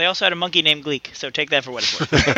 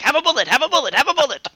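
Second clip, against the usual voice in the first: mock bullet-throwing; 8 semitones higher than usual. Podcaster mocking actors jerking wrists as they shoot, high repetitive bursts. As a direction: chant it as if flinging each one. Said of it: "they were always jerking their wrist forward".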